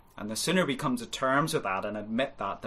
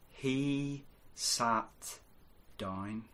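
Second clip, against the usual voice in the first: softer, slow and deliberate; quiet emphasis; 7 dB quieter, much slower than usual. Shown as a demonstration. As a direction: let each word land gently. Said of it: "let me encourage you today to speak these three simple, hope-restoring words".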